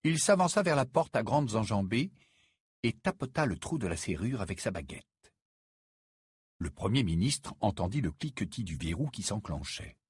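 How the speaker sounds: usual speaking voice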